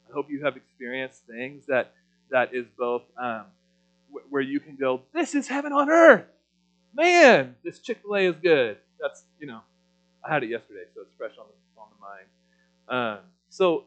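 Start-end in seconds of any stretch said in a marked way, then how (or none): 5.1-8.8 bursting out with high, loud delight; ecstatic exclamation. Pretend. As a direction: gush like it's heaven on earth. Imagined joyful exclamation, food.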